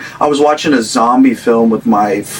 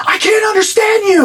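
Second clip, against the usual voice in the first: squeaky, much higher mock-frightened voice; imitating girlfriend, very high. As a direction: pitch it way up like a startled stranger. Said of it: "She goes".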